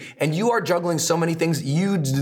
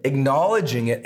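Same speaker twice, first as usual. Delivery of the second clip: ordinary talk, nothing marked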